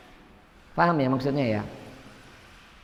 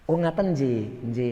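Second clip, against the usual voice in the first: humble polite assent; 5 semitones lower, slower than usual. Retold or imitated. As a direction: agree meekly to end the talk. Humble agreeing reply quoted.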